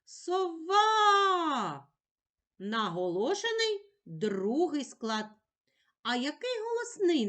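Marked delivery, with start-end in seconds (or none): 0.0-1.9 Сова called out high and loud, stressing «ва»; «Сова» loudly, with «ва» pitched high; calling the word. Shown as a demonstration. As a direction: call Сова as if across a field. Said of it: "Покличемо слово".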